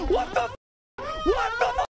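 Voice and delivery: high gasping disbelief; shocked cries. Real, not acted. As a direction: cry out in shock.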